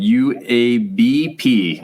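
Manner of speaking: each letter drawn out and punched; slow, careful spelling out. Real, not acted. Host reading a fan's comment, slow so as not to mess up. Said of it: "I gotta say that slow".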